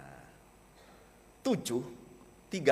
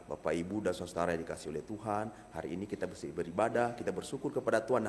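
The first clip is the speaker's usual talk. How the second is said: fast, soft, run-of-the-mill church greeting; quick rattled-off greeting; 5 semitones lower, 6 dB quieter, faster than usual. Shown as a demonstration. Pastor rushing the usual church opening, quick and soft. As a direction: rattle it off, softer and hurried. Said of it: "itu hanya dapat tujuh persen loh".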